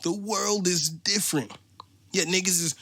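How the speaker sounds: normal talking voice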